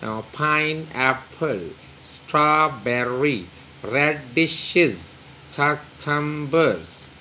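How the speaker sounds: slow list, each word broken into three syllables. Shown as a demonstration. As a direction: say pineapple, strawberry, रेडिशेस, cucumbers in separate, stretched syllables. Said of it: "तो इस तरह से हम इसको". (ہر لفظ کو تین حصوں میں آہستہ توڑ کر بولیں)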